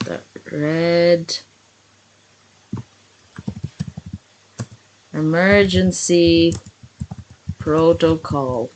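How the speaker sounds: very slow, with long thinking pauses; composing aloud. Real, not acted. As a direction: dictate it word by word as you invent it. Inventing a book title while writing.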